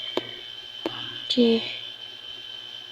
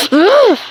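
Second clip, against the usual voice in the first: high, loud play-acted ugh; sharp exasperated "ugh"; exasperated groan; 8 semitones higher, 7 dB louder than usual. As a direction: groan out loud in pretend annoyance. Annoyed mother in pretend play.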